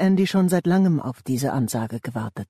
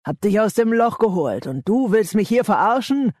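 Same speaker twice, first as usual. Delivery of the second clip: higher, heated outburst of betrayal; angry growl; 6 semitones higher, 4 dB louder than usual. A narrator's reading. Gang leader confronts the man he raised, angry. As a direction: build into an outraged question. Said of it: "knurrte Matt".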